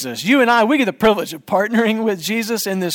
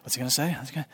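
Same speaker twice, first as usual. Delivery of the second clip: put-on softer, anxious mutter of a nervous teen; nervous hush; 5 semitones lower, 11 dB quieter, much faster than usual. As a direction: whisper it like she's worried what dad will say. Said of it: "breathing heavy".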